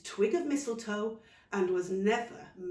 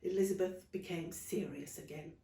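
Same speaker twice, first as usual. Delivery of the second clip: ordinary delivery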